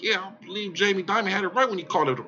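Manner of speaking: lightly voiced smug pundit; mocking the guest. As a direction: parrot the guest with light mockery. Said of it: "He was like".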